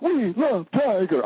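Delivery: high, slow, gushing fan voice; mock the press swooning over Tiger. Mocking the press again.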